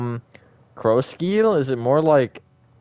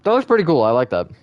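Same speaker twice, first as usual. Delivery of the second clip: excited; 4 semitones higher, 7 dB louder than usual. Real, not acted. Admiring a build, excited.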